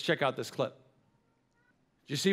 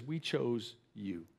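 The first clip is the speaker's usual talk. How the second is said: slow, much softer and lower, tender; tender reassurance; 7 semitones lower, 11 dB quieter, much slower than usual. Retold or imitated. Mother quietly reassures her adopted son, slow and tender.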